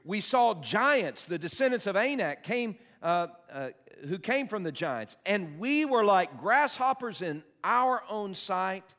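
slow, high, loud awed reading; dramatic scripture reading. Acted. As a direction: draw out the fear of giants.

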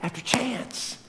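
high, loud, rising preacher's cry; full-force preaching. Acted. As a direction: preach it out to the whole church, driving each repeat.

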